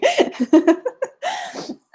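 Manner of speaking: high-pitched burst of laughter; high, rippling laugh; real laugh. Real, not acted. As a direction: giggle helplessly at your own remark. Laughs after her own categorical denial.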